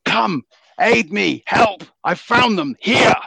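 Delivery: high, loud, drawn-out call for aid; crying out for help. Acted. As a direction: bellow for help to allies far off. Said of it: "and he cries out".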